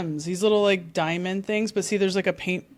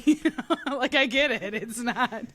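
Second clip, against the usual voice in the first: laughing; 5 semitones higher than usual. Real, not acted. Laughing at herself.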